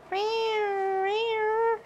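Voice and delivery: high mock cat meows; imitated meow. Owner meowing to converse with her pet cat. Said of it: "Converse with my pet cat".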